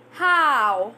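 How stretched with a wide open mouth; open-mouth demo. Shown as a demonstration. Teacher showing students an open mouth on How. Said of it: "Say it proper".

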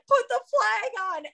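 high-pitched mock bawling voice; imitated bawling. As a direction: imitate someone wailing through tears, high up. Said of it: "And he's bawling".

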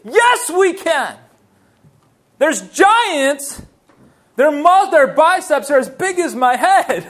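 high, loud, impassioned exhortation; forceful preaching. Acted. Preacher challenging those who look for the negative, forceful preaching. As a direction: push the pitch up and preach hard.

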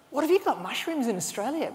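put-on French accent. Young French guy on a foray questioning an Australian, French accent. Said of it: "in a beautiful French accent".

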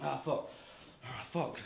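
low, soft stumbling mutter; feigned blanking, quietly cursing yourself; faked choke. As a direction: act like you've forgotten your lines. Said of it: "That ain't a real choke".

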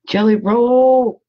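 high, loud, drawn-out cheer; playful call-out. Pretend. Crafter hailing her beloved jelly roll, playful loud call-out. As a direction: sing out the name with relish, stretching it long.

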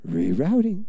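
soft, low soothing prompt; gently redirect, like a calm GPS voice; gentle. Pretend. Preacher voicing God redirecting those off track, gentle. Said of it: "And it's gentle".

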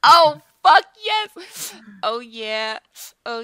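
loud, high, slow victory shout; triumphant yell. Real, not acted. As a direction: celebrate the win at full volume. Pet succeeds at Mega.

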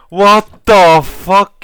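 loud drawn-out shocked yell; shocked shout. Real, not acted. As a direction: blurt it out, stunned and loud. Shocked by a found item.

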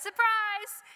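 a big high shout of surprise; loud, high retold yell. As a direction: burst out with it like springing a surprise party. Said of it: "and I yelled out".

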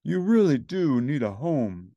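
lightly voiced kindly social worker, slow; slow, coaxing lady voice; a hint of a gentle caseworker; Miss Finch. A narrator's reading. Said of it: "she said".

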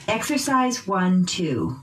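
ordinary talk

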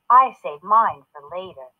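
ordinary delivery

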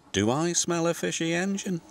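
teasing. A narrator's reading. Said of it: "he teased".

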